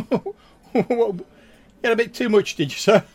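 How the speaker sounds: high, loud teasing barmaid voice. Acted. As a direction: tease him, pitched high and loud. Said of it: "she's like".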